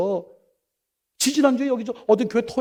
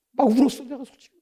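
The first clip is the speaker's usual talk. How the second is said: ordinary talk, nothing marked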